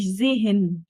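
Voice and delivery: "زهن" with the middle h pronounced; wrong sounded H. Shown as a demonstration. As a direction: break "زهن" with an audible h in the middle. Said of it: "حرف الها في النص، اوعى تنطقه". ("زهن" مع نطق الهاء غلط)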